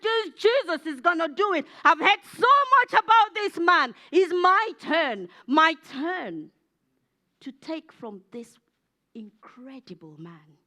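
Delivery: loud, emphatic declaration of faith; fervent determination. Acted. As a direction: cry it out with bold conviction. Preacher acts out believing woman.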